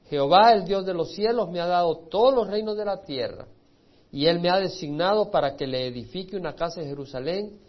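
loud, ringing decree read aloud; proclaiming a royal decree. Acted. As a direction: announce the king's word. Reads Cyrus's decree.

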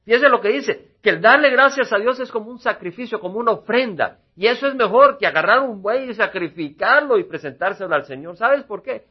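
loud, high preaching at full force. Acted. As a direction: drive the point home to the congregation. Predica con fuerza. (predicando con fuerza y voz alta)

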